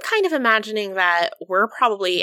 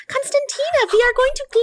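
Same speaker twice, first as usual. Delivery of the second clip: high-pitched, gleeful call; excited calling out; 3 dB louder than usual. Acted. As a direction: call out high, swelling louder. Mercy calls out to Constantina.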